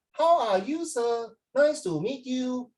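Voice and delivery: put-on high, singsong Hong Kong accent. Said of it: "So in Hong Kong".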